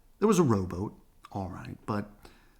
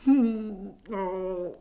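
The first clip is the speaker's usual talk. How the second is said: wrinkled nose; 10 semitones higher, 4 dB louder than usual. A narrator's reading. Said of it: "wrinkling his nose".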